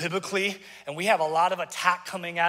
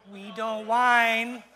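very slow, drawn-out whiny voice; exaggerated, dragged-out whine; mock whining; 2 semitones higher, much slower than usual.

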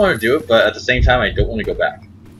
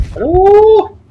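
very high, loud, nervous call into the dark; scared high hello; frightened call; 7 dB louder than usual. Real, not acted. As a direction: call out, hoping nobody answers. Calling out in dark room.

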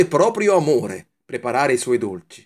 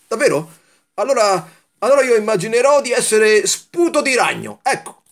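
put-on high, louder childlike voice catching on; pitched-up eager plan, stumbling on allora; excited little candy; 9 semitones higher, 5 dB louder than usual. A narrator's reading. Kalin voiced in the story.

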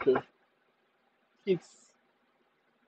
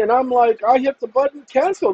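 ordinary talk, nothing marked

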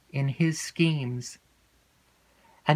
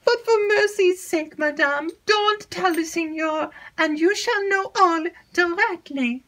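high, anxious begging; pleading maid. Acted. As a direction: beg for mercy in a rush. Annette pleads.

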